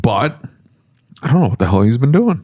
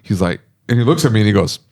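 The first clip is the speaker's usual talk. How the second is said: normal talking voice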